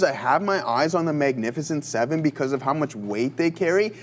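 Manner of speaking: ordinary talk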